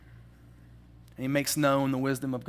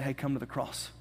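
softer, gentle come to the cross; tender invitation; 3 semitones lower, 7 dB quieter than usual. Retold or imitated. Holy Spirit to the ashamed sinner, a softer tender invitation. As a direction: invite softly, like reaching out a hand. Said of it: "felt the Holy Spirit say".

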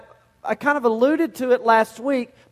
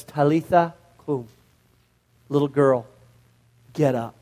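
tender call; 7 semitones lower than usual. Acted. Jesus waking the little girl, low and tender. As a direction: coax the girl awake gently.

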